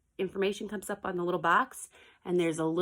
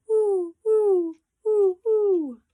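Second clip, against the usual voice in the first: very high, loud hooting woo; owl hoot; 14 semitones higher, 9 dB louder, much slower than usual. A narrator's reading. Teacher reading to children, high loud owl hoots. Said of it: "He can sound like an owl".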